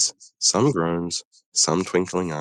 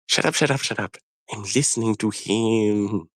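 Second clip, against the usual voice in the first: irritated snap; 5 semitones higher than usual. Acted. Engine snapping at the other.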